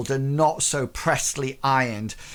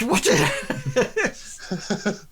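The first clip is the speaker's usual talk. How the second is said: much higher, laughing mock outrage; disgusted cry; slower than usual. Ashton recoils from Bartleby's hand, high and laughing. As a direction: squeal in comic disgust. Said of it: "He goes".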